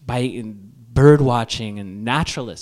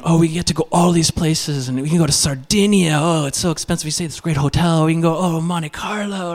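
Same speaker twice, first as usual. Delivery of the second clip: higher-pitched, excited daydreaming; gushing wife; 6 semitones higher than usual. Acted. Wife dreaming of all these places, high excited gushing. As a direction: gush through a wish list of trips. Wife's gushing thoughts about luxury trips.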